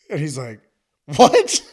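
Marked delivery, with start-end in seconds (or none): 1.1-1.6 "What?" shouted high and loud in disbelief; shocked "What?". Retold or imitated. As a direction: jump in with astonishment. Interviewer stunned by his answer.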